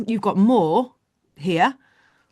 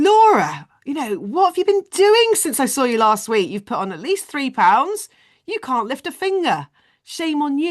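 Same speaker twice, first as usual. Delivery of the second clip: raised, scolding trainer voice; shaming call-out; 7 semitones higher, 4 dB louder than usual. Acted. Trainer shaming a client over weight gain, scolding. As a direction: tell them off, high and reproachful. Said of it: "Calling out would be saying".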